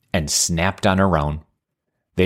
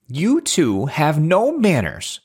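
mild storybook yell; 8 semitones higher, slower than usual. A narrator's reading. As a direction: scold them in a stretched, raised-pitch call. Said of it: "Auntie yelled".

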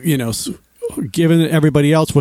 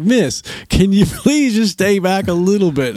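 slowed, slightly raised-pitch imploring; mock polite plea; 5 semitones higher than usual. Dad asking a selfie taker to back off, strained polite plea. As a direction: plead with strained politeness, drawing it out.

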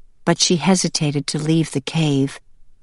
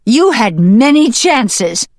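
raised, emphatic reproach from a mother hen; accusing hen; 6 semitones higher, 7 dB louder than usual. Acted. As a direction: press each charge louder and higher.

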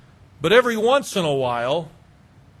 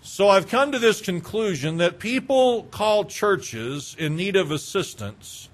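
ordinary talk

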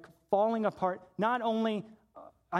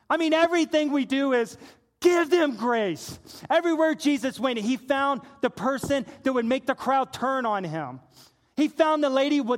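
raised, ringing pulpit delivery; fervent sermon voice; impassioned preaching; 4 semitones higher, 6 dB louder than usual. Real, not acted. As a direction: preach it with building force. Preaches at full force.

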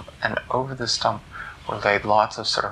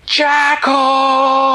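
much higher, loud drawn-out shout; loud summoning shout; full yell; 14 semitones higher, 8 dB louder than usual. Acted. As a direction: yell with everything. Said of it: "took a deeper breath and yelled".

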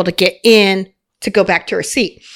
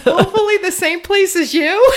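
laughing retold line; 8 semitones higher than usual. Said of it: "retold flirty reply".

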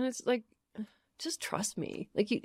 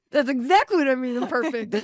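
emphatic agreement; 6 dB louder than usual. Retold or imitated. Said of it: "And I was like".

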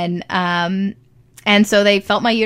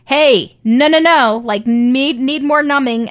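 loud, raised-pitch objection, acted out; urgent protest; 5 semitones higher, 5 dB louder, slower than usual. Patient to midwife stitching where not numb, urgent protest. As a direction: re-enact stopping someone who is hurting you.

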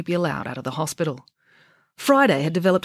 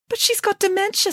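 put-on higher young doctor objecting. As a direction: protest to Friday.

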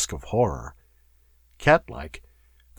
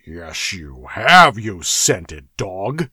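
snarled; 2 semitones lower, 4 dB louder, much slower than usual. A narrator's reading. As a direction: drag the insult out with menace. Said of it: "snarled the Cimmerian".

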